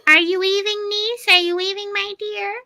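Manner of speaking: put-on high, sing-song old witch calling; mock-sweet witch voice calling out; sweet-talking witch. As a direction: coo up at the window like a crafty hag. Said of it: "Baba Yaga, like, you know, yells out to the window".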